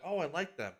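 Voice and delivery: put-on a little kid approving happily; imitating small son. Mom imitating her son liking the characters, light child voice. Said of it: "he's like".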